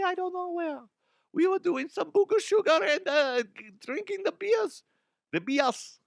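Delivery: high-pitched hammed-up German protest; terrible German accent. German owner pleading ignorance about the missing trophy, terrible German accent. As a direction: play a squeaky mock-German in over his head. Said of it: "That's a terrible German accent".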